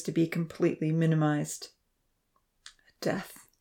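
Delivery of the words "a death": slow, soft, choked with tears; tearful question. A narrator's reading. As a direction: ask it as if tears are falling. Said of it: "Jack questioned, as tears rolled".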